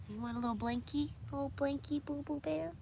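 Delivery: high cooing pet voice; high-pitched doting babble. Real, not acted. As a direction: coo sweetly to a pet. Baby talk to the pet.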